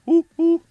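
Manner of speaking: very high, loud hooting; owl hoot. Shown as a demonstration. Streamer told it sounds like a train, loud hooting. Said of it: "it's not an owl".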